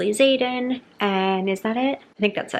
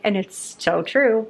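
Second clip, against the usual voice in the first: usual speaking voice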